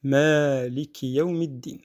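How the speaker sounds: slow Maliki Yawmiddin with a long maa; lengthened madd. Shown as a demonstration. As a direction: stretch the first a of Maliki. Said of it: "d'étendre un petit peu le souffle".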